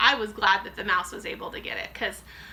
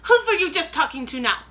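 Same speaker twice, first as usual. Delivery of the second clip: sharp, higher and louder demand; witch snapping; 6 semitones higher, 7 dB louder than usual. A narrator's reading. Grand High Witch snapping at the grandmother below. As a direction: bite the question off. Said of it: "snapped the Grand High Witch".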